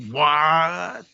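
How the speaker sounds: drawn-out rising shocked "what"; incredulous, long cartoon reaction; shocked Chopper. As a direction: stretch it out in mock disbelief. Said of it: "Chopper's like".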